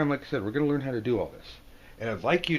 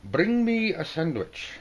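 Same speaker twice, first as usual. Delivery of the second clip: bossy order; 9 semitones higher, 4 dB louder, slower than usual. Acted. Voicing bossy card figure.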